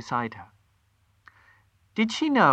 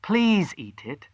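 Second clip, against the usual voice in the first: slow, higher pleading; begging; 6 semitones higher, slower than usual. Acted. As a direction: coax them gently to eat. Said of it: "she begged".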